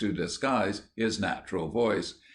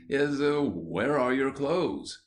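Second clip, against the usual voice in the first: almost tearful; 5 semitones higher, slower than usual. A narrator's reading. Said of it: "said Missus Brown almost tearfully".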